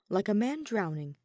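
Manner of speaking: ordinary delivery, nothing marked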